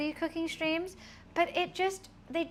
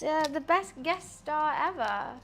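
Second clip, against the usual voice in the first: ordinary talk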